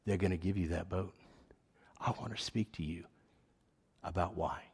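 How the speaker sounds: soft, gentle quoted voice; gentle voice of God. Retold or imitated. God speaking to him, a soft gentle voice. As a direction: tell it kindly and quietly. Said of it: "gentle, kind voice".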